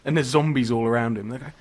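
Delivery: ordinary delivery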